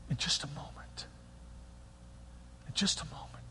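breathy preacher's whisper for effect. Real, not acted. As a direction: let it fall to a whisper. Hushed repeat for effect.

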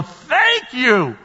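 high, joyful holler with stretched words; exuberant shouted thanks. Acted. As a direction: cry out thanks at the top of your range. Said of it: "Jesus is gonna go".

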